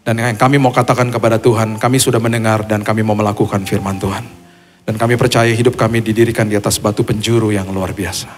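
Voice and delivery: loud, fervent preacher's prayer; closing prayer. Acted. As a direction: lead the congregation in prayer with force. (doa penutup yang lantang dan berapi-api)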